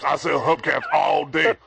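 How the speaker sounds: ordinary talk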